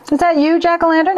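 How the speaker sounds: high, bright hail out into the fog; jovial call. Acted. Narrator hails the blinking light, jovial call. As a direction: call out jovially into the dark marsh. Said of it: "I called jovially".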